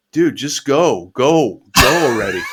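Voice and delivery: loud, slow hammed-up farewell; overacted death scene. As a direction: beg them to leave, melodramatic. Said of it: "and I go".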